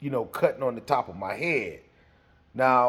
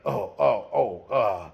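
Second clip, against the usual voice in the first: stuttering; 5 semitones lower than usual. Retold or imitated. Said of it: "he starts stuttering".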